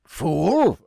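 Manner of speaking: hammed-up pirate indignation; indignant pirate captain. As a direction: round on them as an affronted captain. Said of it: "the captain stops and turns and goes".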